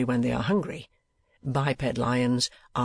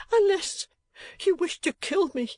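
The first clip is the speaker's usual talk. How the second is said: sobbing plea. A narrator's reading. Wife begs her rich uncle to stay, sobbing. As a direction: plead through sobs. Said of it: "sobbed Missus Kenwigs".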